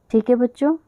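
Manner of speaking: ordinary delivery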